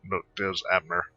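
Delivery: normal talking voice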